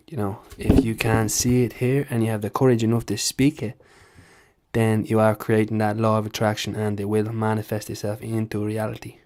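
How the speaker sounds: impression of a Dublin fighter's Irish accent; Conor McGregor. Impressionist as a fighter talking about law of attraction, Dublin accent. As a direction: preach self-belief in a Dublin accent. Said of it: "you know, Conor McGregor".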